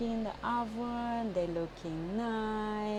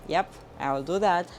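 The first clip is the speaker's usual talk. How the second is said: normal talking voice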